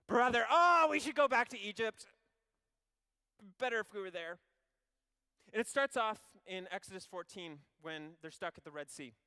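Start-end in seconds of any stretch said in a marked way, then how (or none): 0.1-4.4 loud, high whiny grumble; whining Israelite. Acted. Israelite moaning to Moses about Egypt, loud whine. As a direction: moan like a fed-up complainer.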